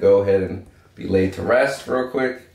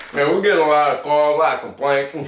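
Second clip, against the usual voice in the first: mock cop; 7 semitones higher than usual. Cop voice, police called on them.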